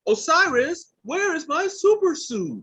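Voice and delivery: high, loud, drawn-out holler; mock frantic calling out. Podcaster jokingly calling Osiris about a missing suit, mock frantic holler. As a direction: call across the house in exaggerated alarm. Joke call demanding a super suit.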